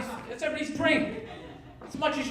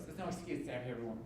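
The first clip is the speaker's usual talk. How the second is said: mocking TV line; 12 semitones lower, 16 dB quieter, much faster than usual. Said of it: "They always say that on shows".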